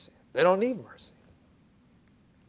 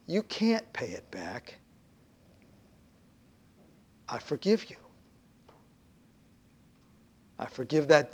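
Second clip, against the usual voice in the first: lower, soft and gentle forgiveness; compassionate master; 5 semitones lower, 4 dB quieter than usual. Acted. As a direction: forgive him tenderly.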